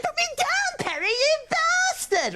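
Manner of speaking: put-on shrieking girlfriend. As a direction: shriek while beating on his chest.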